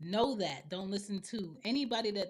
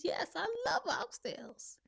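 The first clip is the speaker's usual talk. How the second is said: high, drawn-out sarcastic answer; mock enthusiasm; 11 semitones higher than usual. Parent answering a kid who watched them eat, mock enthusiastic. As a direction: stretch it out high with exaggerated relish.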